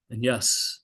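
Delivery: ordinary talk, nothing marked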